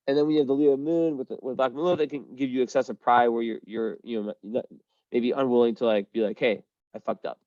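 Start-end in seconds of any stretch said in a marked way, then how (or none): none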